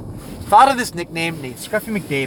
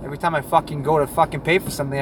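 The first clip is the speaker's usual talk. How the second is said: ordinary delivery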